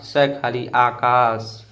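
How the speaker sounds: स खाली आकाश said slowly, letter by letter; teacher spelling Akash slowly. Shown as a demonstration. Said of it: "ऐसे पढ़ा जाता है".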